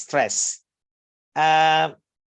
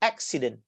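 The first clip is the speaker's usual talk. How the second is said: "accident" stressed on AC, then falling lower; first-syllable stress; 4 semitones lower, 6 dB quieter than usual. Shown as a demonstration. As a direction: hit the first syllable of "accident", let the rest drop. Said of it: "here you stress".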